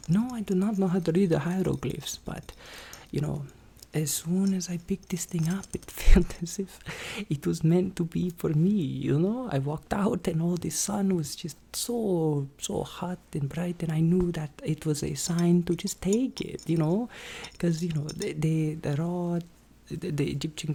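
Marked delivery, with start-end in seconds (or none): 3.9-6.8 whispered telling; asmr whisper. Acted. 12.6-16.7 whispered telling; asmr whisper. Acted.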